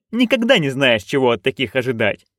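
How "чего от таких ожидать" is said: plain reading voice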